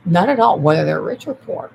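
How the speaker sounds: loud insistent "Not at all"; shouted denial. Real, not acted.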